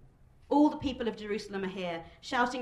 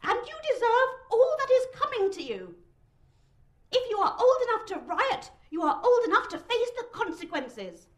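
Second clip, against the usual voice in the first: high, loud, strained tirade; indignant high-pitched warning; angry threat; 14 semitones higher, 7 dB louder than usual. A narrator's reading. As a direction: threaten the mob in a thin, raised voice. Humiliated prefect threatens the rioting crowd.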